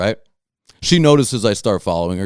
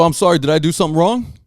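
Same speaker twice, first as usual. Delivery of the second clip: higher, quick mock apology; feigned innocence; 7 semitones higher, faster than usual. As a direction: act as if you have no idea. Playing innocent with angry driver.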